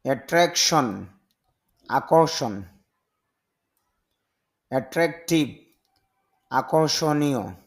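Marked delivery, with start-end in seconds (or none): none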